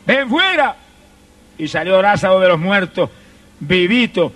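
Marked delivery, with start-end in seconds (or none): 0.1-0.8 Jesus calls out, higher pitch; shouted command. Acted. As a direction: call Lazarus out of the tomb. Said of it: "le gritó dos palabras".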